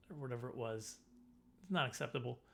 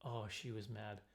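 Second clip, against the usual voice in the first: usual speaking voice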